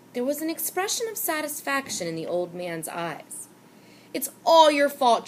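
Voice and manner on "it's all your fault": loud, high accusation; declaring. A narrator's reading. Boy loudly blames the storekeeper. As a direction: throw the blame at him loudly. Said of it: "John declared".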